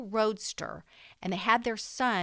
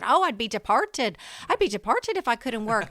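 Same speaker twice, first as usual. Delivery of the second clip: put-on higher, lilting Irish brogue of an old grandmother; grandmother's Irish accent; 5 semitones higher, 6 dB louder than usual. Granddaughter imitating her dramatic Irish grandmother's brogue. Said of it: "that's a great accent".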